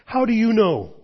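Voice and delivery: put-on high, loud boyish demand; loud, high-pitched challenge; challenging question. A narrator's reading. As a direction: demand an answer in disbelief. Boy challenges the voice.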